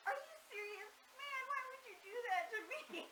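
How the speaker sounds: imitated dad, high and breaking into laughter; mock dismayed disbelief. Daughter voices dad learning of the prank, high mock dismay. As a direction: wail it like a pranked father, half laughing.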